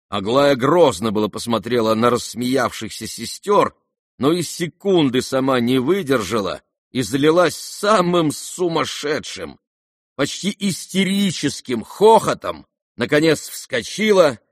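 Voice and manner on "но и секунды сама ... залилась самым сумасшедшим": plain reading voice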